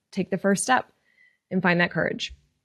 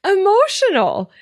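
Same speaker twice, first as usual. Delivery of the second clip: high, louder, laughing through tears; real tears catching in a laugh; teared up; 11 semitones higher, 3 dB louder than usual. Real, not acted. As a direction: break into a teary laugh. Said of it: "I'm getting teared up".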